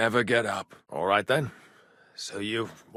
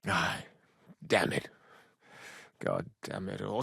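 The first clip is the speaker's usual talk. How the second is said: low, very soft curses; bitter under-the-breath swearing; muttered cursing; 5 semitones lower, 10 dB quieter than usual. Acted. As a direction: grumble it half to yourself. Cursing over lost comrade.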